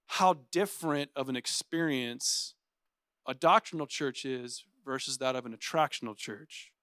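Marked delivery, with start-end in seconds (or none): none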